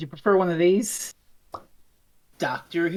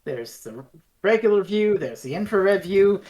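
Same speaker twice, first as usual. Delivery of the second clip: ordinary talk, nothing marked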